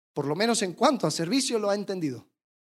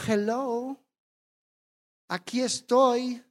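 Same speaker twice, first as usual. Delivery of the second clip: a hint of a sulky, offended churchgoer, softer; mock wounded pride; 4 dB quieter than usual. Preacher acting a churchgoer who feels ignored, mildly sulky. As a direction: whine lightly that nobody noticed you. Acted sulking of someone ignored.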